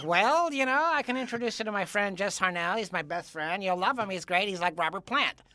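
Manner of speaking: lightly put-on wannabe Texan voice; a hint of a cartoon character. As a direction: mimic the odd friend making a pitch. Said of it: "He talked like this".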